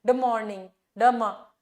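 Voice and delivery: ड मॉर्निंग, ड मा broken out to show 'd' running into 'm'; sound-junction demo. Shown as a demonstration. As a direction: isolate the join of ड and मा in ड मॉर्निंग, ड मा. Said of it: "ड और मा का जो इन्फ्लुएंस है". (ड मॉर्निंग, ड मा में 'ड' और 'म' का जोड़ अलग करके)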